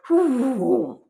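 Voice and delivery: imitated swooping "whoo"; airy falling whoosh; zooming whoosh. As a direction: make the sound of zooming down from the sky. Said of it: "it was literally like zooming in".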